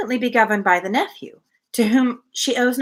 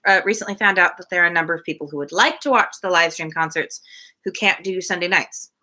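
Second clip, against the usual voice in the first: ordinary delivery, nothing marked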